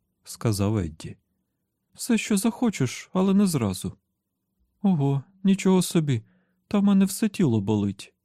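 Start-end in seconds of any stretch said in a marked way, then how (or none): 1.9-8.1 high-pitched voice of a grumbling woman; narrator's high female character voice; just-woken woman, complaining. Acted. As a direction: lift the voice high for her achy complaint.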